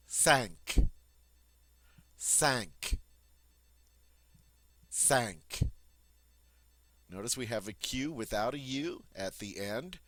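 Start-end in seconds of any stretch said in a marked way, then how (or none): 0.1-5.6 cinq with a nasal vowel and a K at the end; French cinq. Shown as a demonstration. Teacher modeling cinq for students, nasal French vowel. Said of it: "on dit".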